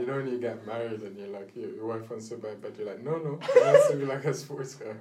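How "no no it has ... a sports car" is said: loud, higher mock protest through laughter; mock insistence. Teasing a co-host as a future husband, mock-insistent. Acts out husband arguing over bed.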